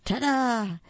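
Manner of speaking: high, loud triumphant reveal. A narrator's reading. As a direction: present it like a magic trick. Narrator reveals the gift.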